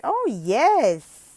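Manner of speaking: loud, gasping delight, slowly; excited gasp. Shown as a demonstration. Tutor acts thrilled that the text says Texas. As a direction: act thrilled about Texas. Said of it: "And then like".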